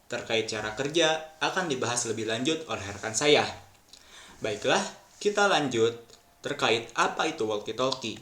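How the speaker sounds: normal talking voice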